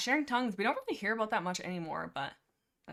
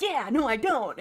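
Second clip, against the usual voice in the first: loud, higher mock outburst; eager defiance; 5 semitones higher, 9 dB louder than usual. Apprentice answering a challenge, eager defiance. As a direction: blurt it out, rising to the dare.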